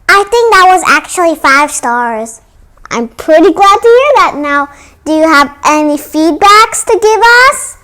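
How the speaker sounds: put-on loud, high children's channel sign-off; excited outro. A kids' channel host thanking viewers, loud and bright.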